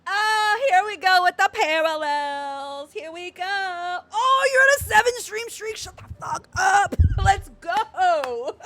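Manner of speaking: loud, high, drawn-out hollering; excited yelling. Real, not acted. Streamer freaking out over parallels in the game, yelling. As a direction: holler it out, stretching the words.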